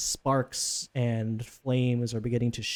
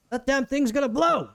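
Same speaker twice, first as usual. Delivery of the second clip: higher, louder cry of alarm; shouted warning; 12 semitones higher, 5 dB louder than usual. Acted. Woman on the walkway yells down to the crew, shouted warning. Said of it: "she shouts down".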